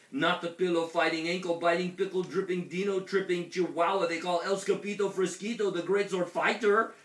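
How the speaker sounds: high, loud, breathless list of titles; alarmed shriek. A narrator's reading. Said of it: "shrieked the T-Mexito".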